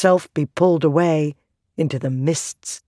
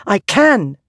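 defiant cry; 5 semitones higher, 4 dB louder than usual. A narrator's reading. Shallan insists.

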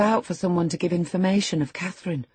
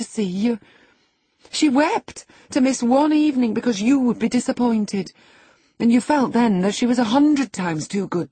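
starts measured, builds high and loud; indignant reproach; 6 semitones higher, 5 dB louder than usual. A narrator's reading. Housekeeper reminds the boy of Catherine's kindness, reproachful. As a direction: scold him with mounting passion.

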